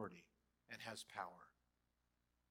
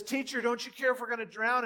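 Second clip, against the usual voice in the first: high, loud desperate cry; panicked shout; 9 semitones higher, 9 dB louder than usual. Acted. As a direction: scream for help in the storm. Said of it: "And he says".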